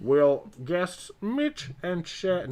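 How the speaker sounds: slow ship announcement voice; hammy cruise PA announcer; bad acting. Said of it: "This is like the muster drill when they start calling you".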